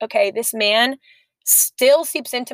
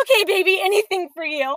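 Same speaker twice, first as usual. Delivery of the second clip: put-on besotted girlfriend; 8 semitones higher than usual. Her besotted reply to him.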